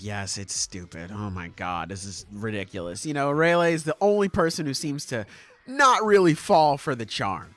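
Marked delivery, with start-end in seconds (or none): 0.0-3.0 very low and soft; understated, dropped-voice grumble; mock confiding agreement. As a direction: agree under your breath. Retold private agreement, hushed.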